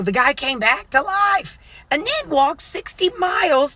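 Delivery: high-pitched, excited disbelief; imitating an astonished storyteller; amazed retelling. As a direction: retell it as an astonishing feat, voice shooting up. Retelling guide's amazement at Paul.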